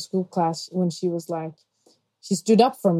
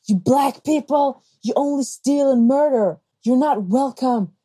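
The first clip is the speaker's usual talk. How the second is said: mock screaming; 6 semitones higher than usual.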